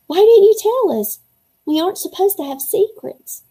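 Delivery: upset high outcry. Acted. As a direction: cry out, hurt and upset. Hurt outburst at hidden secret.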